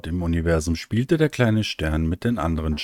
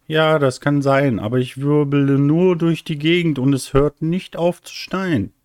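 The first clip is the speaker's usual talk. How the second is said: sehr hohe, klagende Kinderstimme; verzagter kleiner Stern; 8 semitones higher than usual. Acted. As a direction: klag hoch und kindlich über den Schneesturm. (kindlich klagend)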